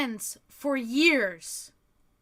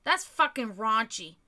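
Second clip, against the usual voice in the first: ordinary talk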